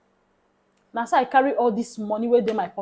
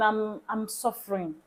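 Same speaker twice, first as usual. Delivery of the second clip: quiet plea; 8 dB quieter, slower than usual. Retold or imitated. Stranger pleading quietly.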